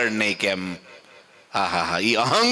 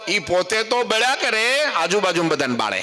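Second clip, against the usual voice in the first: impassioned preaching; 9 semitones higher than usual. Acted. Preacher warning the gathering, high and impassioned. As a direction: drive home the misery of ego.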